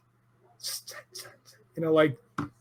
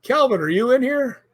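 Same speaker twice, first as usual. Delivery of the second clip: calling out; 9 semitones higher than usual. Retold or imitated. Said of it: "I said".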